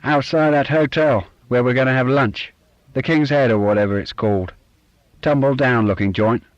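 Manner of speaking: loud, blunt Cockney dad; strong Cockney accent. Acted. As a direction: bark it out in Cockney. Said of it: "in his strong Cockney accent".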